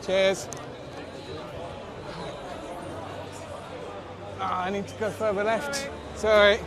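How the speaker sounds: pushing through a crowd, apologising; squeezing past people in a crush; squeezing through a gap. Real, not acted. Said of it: "just squeezing through".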